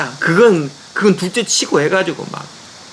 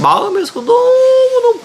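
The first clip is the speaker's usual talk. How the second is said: voice breaking. Real, not acted. Recalling son's hardship, voice breaks.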